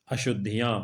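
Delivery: ordinary talk, nothing marked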